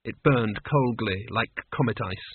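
ordinary delivery, nothing marked

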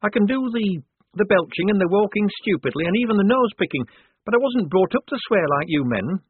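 high, loud protesting character voice; flustered young recruit. Acted. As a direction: rattle off an embarrassed protest, pitched high. Shufti protests about acting male.

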